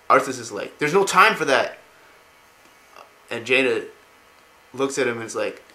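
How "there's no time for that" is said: loud, higher retold snap; urgent refusal. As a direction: cut off the plea, acting the impatient prince. Arthas refuses Jaina's plea.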